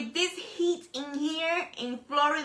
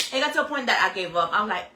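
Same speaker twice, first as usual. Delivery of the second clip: ordinary talk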